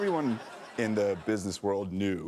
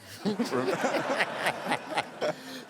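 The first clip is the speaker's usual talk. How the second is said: high laugh; 10 semitones higher than usual. Real, not acted. Laughing at his own joke.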